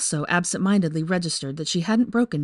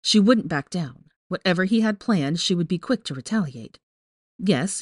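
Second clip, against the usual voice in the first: ordinary delivery, nothing marked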